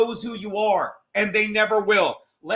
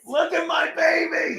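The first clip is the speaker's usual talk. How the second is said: high, drawn-out mock cry; mock baby-talk exclamation; 9 semitones higher than usual. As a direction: stretch it out high in a put-on voice. Acting out mirror scene.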